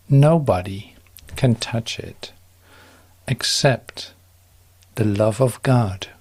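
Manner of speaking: ordinary delivery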